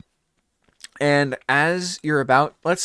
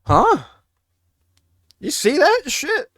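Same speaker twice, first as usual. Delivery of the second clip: real surprise; 13 semitones higher, 3 dB louder, slower than usual. Real, not acted. Lights suddenly turn blue.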